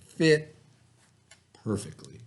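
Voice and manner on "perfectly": ordinary delivery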